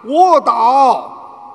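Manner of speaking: sudden loud warning cry; urgent shout. Acted. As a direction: yell it at the child to drop down. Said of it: "他冲着孩子大喊". (急促大喊)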